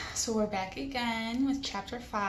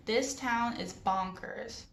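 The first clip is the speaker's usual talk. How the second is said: plain reading voice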